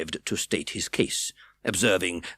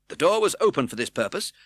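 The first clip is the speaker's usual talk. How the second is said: ordinary delivery, nothing marked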